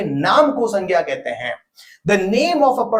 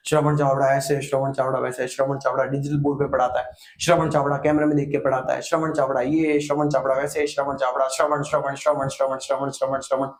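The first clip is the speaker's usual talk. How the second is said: low, monotone droning list of the same name; grating name repetition; 4 semitones lower than usual. Shown as a demonstration. Teacher showing why repeated nouns sound odd, monotonous repetition. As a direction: repeat the name mechanically until it grates. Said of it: "odd लग रहा है, सुनने वाले कानों में चुभ रहा है".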